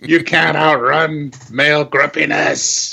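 haunt him from behind; taunting voice. Pretend. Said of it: "calling after you".

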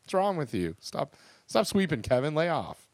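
high-pitched playful scolding, only half shouted; mock-yell at a sweeper to quit. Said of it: "but we just yelled out".

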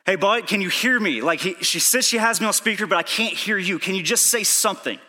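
loud, high-pitched phone plea; urgent pleading. Retold or imitated. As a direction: beg a friend to answer. Retold phone plea while speeding.

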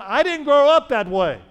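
a churchgoer objecting to the pastor, high and loud; imagined objector. Pretend. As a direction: play the congregant pushing back. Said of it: "But you say".

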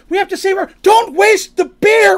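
high, loud, slowed comic wail; mock panic. Cole and Darsh mourning the spilled dwarven spirits, loud comic wail. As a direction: wail like losing treasure. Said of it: "they're like".

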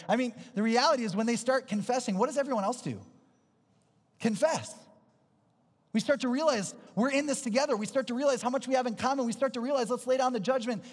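high, driving sermon voice; rousing preaching. Acted. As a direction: build the congregation up with conviction. Preaches at full force.

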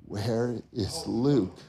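low, slow and stunned; stunned realization. Retold or imitated. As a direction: let the dread sink in, slowly. Realizing his son is missing.

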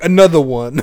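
shouted, teasing; loud mock announcement. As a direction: call it out loud. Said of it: "And you're like".

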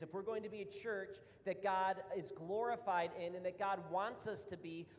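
usual speaking voice

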